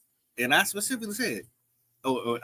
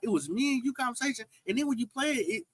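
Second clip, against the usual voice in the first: high-pitched insistence; 8 semitones higher, 4 dB quieter than usual. Real, not acted. Guest defending what he said in context, high-pitched insistence. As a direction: voice gone up high, not shouted. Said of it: "the pitch of my voice go up and high".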